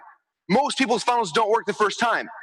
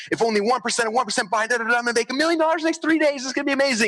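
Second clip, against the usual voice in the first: quick, higher, giddy money daydream; dreamy excitement; 7 semitones higher, faster than usual. Retold or imitated. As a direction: rattle off the math, starry-eyed. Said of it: "they're like".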